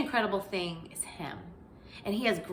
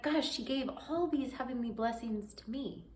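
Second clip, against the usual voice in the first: affirmations; 6 dB quieter than usual. Retold or imitated. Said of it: "and then I'm like".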